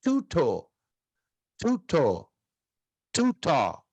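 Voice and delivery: "Tuto" as TOO-toh with a puff on each t; English-like sound. Shown as a demonstration. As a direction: repeat "Tuto" evenly, stress on TU. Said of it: "Parece algo parecido com o inglês".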